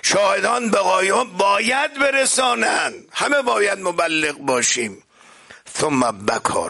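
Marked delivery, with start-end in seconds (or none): 0.0-5.0 high, ringing exhortation; impassioned preaching. Acted. Preacher urging listeners that all must spread the message, high impassioned call. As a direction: cry it out, then settle slightly.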